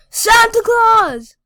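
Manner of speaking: loud drawn-out shout. Real, not acted. Shouting at Santa character.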